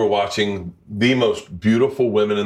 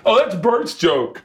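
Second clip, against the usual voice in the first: mock recognition; 11 semitones higher, slower than usual. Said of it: "I'll be like".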